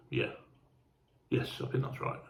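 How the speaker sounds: voiceless, hushed self-check; soft murmured confirmation; whispered to himself. Real, not acted. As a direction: whisper it as if double-checking alone. Checking train route to himself.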